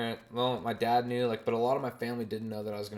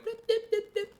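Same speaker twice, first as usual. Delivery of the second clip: very high mocking blips; squeaky blip chatter; 3 dB louder than usual. Mocking mom chatting away, very high blips. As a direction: chatter like a toy.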